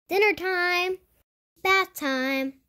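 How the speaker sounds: usual speaking voice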